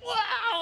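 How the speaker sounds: high-pitched mock bawl; hammed-up cry while flopping down; imitated wail. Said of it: "It was just like".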